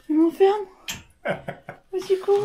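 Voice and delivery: high, loud playful cry for help; mock cry for help. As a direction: ham up being locked in. Mock prisoner cry, locked in. (appel au secours pour rire)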